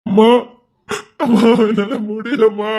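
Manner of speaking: slow wailing cry to mother; sobbing out to his mother; sobbing breakdown. Acted. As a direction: let it break, drawn out. Drunk man breaking down.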